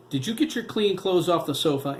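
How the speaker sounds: high, loud sharp question; angry mother snapping at her son. A narrator's reading. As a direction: snap it at the child. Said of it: "Anna snapped".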